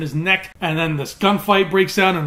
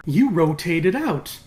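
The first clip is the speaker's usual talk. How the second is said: ordinary delivery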